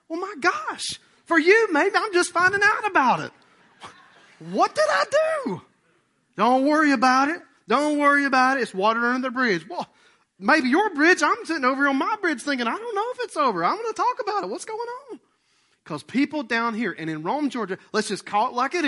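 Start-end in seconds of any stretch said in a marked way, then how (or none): 0.1-5.6 high, loud exasperation; indignant protest. Acted. Person just learning of an old grudge, indignant. 6.4-9.7 loud, raised-pitch dismissal; insistent brush-off. Acted. Grudge holder closing the subject, loudly dismissive. 9.7-15.2 high-pitched, worked-up protest; exasperated comeback. Acted. Wronged person refusing to drop the grudge, exasperated.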